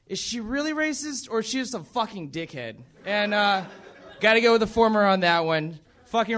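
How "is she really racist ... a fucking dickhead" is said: thinking aloud. Retold or imitated. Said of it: "I was thinking, like".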